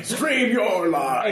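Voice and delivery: slow high commercial voice; ad slogan. Acted. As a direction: sell it like an ad.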